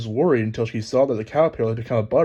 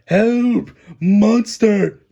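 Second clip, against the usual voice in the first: high, loud terrified yelp; frantic rabbit shouting help; panicked cry; 9 semitones higher, 8 dB louder, much slower than usual. Acted. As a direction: scream for help at a monster. Rabbit screams at giant Alice.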